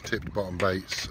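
normal talking voice

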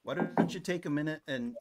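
ordinary delivery

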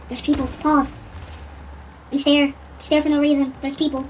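high, loud urgent callouts; urgent excited. Real, not acted. Gamer dog reacting loudly to enemy players, high and urgent. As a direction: react fast and loud to spotted enemies.

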